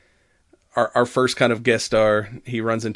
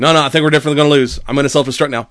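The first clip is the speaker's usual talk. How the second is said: droid rushing; 3 semitones higher, 6 dB louder, much faster than usual. Retold or imitated. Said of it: "he's like".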